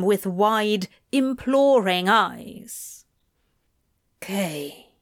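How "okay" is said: hushed, near-whispered okay. Acted. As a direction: breathe it out softly. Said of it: "Crowley whispered".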